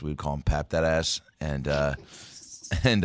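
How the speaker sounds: ordinary delivery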